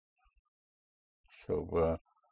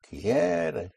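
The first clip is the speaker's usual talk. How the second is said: "Quiere" higher, QUIE held long then falling; present tense push; 4 semitones higher than usual. Shown as a demonstration. Teacher repeats the corrected word, first-syllable stress. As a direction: lean on the start of "Quiere", light ending. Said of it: "where the present tense push is".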